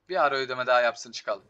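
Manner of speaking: ordinary talk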